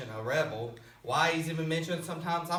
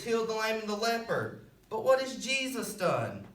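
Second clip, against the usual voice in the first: high, indignant pulpit cry; impassioned preaching; 6 semitones higher, 3 dB louder than usual. Real, not acted. Preacher protesting the crowd's choice of prisoner, impassioned. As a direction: preach it in rising outrage. Preacher argues Barabbas against Jesus.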